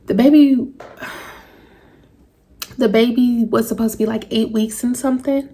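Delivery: real tears, voice catching and breaking; halting, tearful, stopping to steady herself; emotional. Real, not acted. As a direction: fight through crying to finish. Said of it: "I'm getting emotional".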